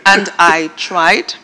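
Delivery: ordinary talk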